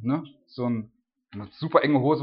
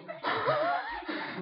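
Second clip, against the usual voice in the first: high burst of laughter; 6 dB quieter than usual. Real, not acted. Laughs at own image.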